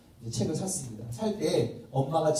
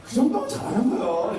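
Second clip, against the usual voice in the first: higher, louder strained praise forced through clenched teeth; through gritted teeth; 7 semitones higher, 4 dB louder than usual. Retold or imitated. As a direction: praise your son while biting down hard. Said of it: "이를 두 번 깨무는 거죠".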